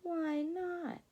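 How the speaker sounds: high, puzzled little caterpillar; hurt why not. Acted. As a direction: ask it small and confused. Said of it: "asked Charlie".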